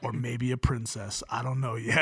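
very low, soft monotone; deadpan little niece. Retold or imitated. Little niece telling uncle her ambition, hushed deadpan. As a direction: say it low and quiet, dead serious.

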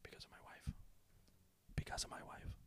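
unvoiced whisper, barely there. Shown as a demonstration. Podcaster acting out a whispered caption, unvoiced whisper. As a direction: breathe it like a secret aside. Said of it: "it should whisper".

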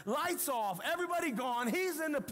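building from alarm to a high shout; panicked wife. Retold or imitated. Wife realizing they left their baby at church, panicking.

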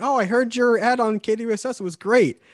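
high, eager mock fan praising the ad; imitating an excited fan. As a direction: voice a stranger thrilled to have heard him. Strangers praising the ad, voiced.

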